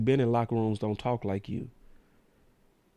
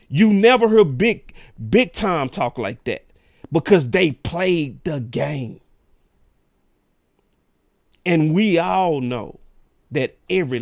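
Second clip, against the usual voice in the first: louder, slowing, hammering each point; heated rant; 3 semitones higher, 6 dB louder than usual. Real, not acted. Host defends locker room culture, loud and slow.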